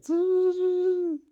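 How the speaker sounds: high-pitched window-lowering noise; imitated window whirr. Imagined prankster lowering the car window, high mimicked whirr.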